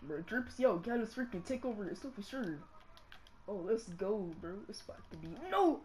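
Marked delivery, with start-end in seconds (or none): 5.5-5.8 shocked no. Real, not acted. Shocked at missed game play.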